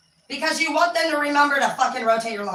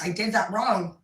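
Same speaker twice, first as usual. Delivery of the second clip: ordinary delivery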